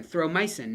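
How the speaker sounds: "throw mycin" said as "throw my-sin"; correct form. Shown as a demonstration. Teacher drilling the ending, "throw mycin" as throw my-sin. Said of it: "not throw myosin".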